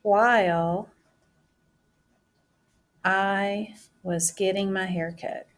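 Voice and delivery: drawn out, word by word as she writes; teacher dictating her sentence while writing; slow dictation. Shown as a demonstration. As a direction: say it at writing speed for the class.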